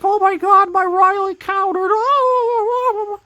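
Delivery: high-pitched hysterical streamer screaming; mock yelling. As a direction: parody young gamers yelling at the screen. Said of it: "young people that are just yelling at the screen".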